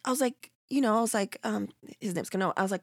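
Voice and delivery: ordinary delivery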